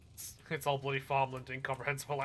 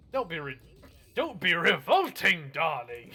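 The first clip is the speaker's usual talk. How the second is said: louder, theatrical don't be revolting; loud mock rebuke; 10 dB louder than usual. Real, not acted. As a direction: scold chat like a dramatic aunt. Mock-posh rebuke to chat.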